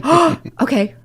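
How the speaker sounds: gasp, then high-pitched understanding; mock sudden realization. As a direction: act out the penny dropping. Mom grasps meaning of plaster.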